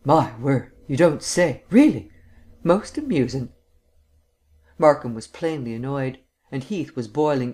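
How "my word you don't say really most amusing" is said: mock surprise. A narrator's reading. Vance teasing Heath with mock surprise.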